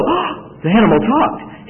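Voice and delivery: gasp, then high, slowed amazement; mock astonishment. Preacher showing how Balaam never reacted to the talking animal, mock astonished. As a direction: act out a startled double take. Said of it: "Balaam doesn't even go".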